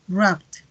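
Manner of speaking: "wrapped" as one syllable closing on t; T-like ending. Shown as a demonstration. Teacher drills past forms, "wrapped" with T-like ending. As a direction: add a released T to end "wrapped". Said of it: "Suena un poquito como una T".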